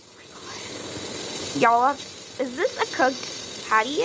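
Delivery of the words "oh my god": slow, whispered relief; breathless whisper. Real, not acted. Gamer whispering in relief after finally succeeding. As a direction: let it out as a spent whisper.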